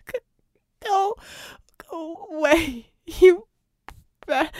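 high, halting sobs, slow and loud; broken sobbing. A narrator's reading. Vicky sobbing at Red to go away, high and broken. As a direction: choke out the words through tears.

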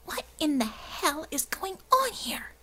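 furious hissed whisper. A narrator's reading. Angry blue woman pinning John to the wall, hissing. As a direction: hiss it through the teeth, enraged. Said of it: "Belladonna hissed at him".

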